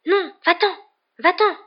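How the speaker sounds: high, loud urgent plea; frantic high-pitched command; panicked shooing. A narrator's reading. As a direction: shoo it away in alarm. Hermione shoos the approaching cat.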